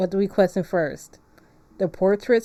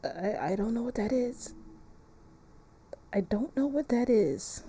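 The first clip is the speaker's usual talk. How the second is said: nervous hush; 4 semitones higher, 8 dB quieter than usual. Real, not acted. Hears eerie noise in game.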